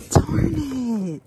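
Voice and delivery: sudden high, loud darn; frustrated outburst. Real, not acted. Reacting to the damaged sign with a loud high outburst. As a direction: blurt it out in dismay.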